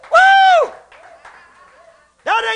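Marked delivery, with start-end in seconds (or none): 0.1-0.7 high loud woo; ecstatic whoop. Real, not acted. Preacher whooping with joy to the crowd. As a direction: let out a joyful whoop.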